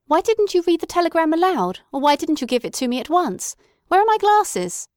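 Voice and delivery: high, loud impatient scolding. A narrator's reading. Mother scolding her daughter over the telegram, impatient. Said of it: "said her mother impatiently".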